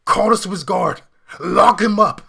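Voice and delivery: high, very loud hammed-up yell; Vigano's mock outraged shout. Host parodying an archbishop calling the guard, mock shouting. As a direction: bellow it like a panicked demand for an arrest. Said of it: "Vigano says".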